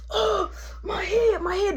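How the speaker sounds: high, slow hammed-up groaning; mock groan. Headbutted dude whining about his head, high and slow. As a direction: ham up the hurt dude holding his head.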